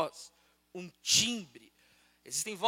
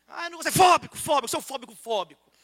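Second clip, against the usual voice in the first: hammed-up whiny outburst, higher and louder at first; mock tantrum; 6 semitones higher, 7 dB louder, much faster than usual. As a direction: parody a hissy fit. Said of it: "Hoje é um chilique". (chilique exagerado, zombando)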